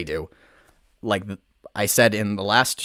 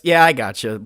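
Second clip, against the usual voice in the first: usual speaking voice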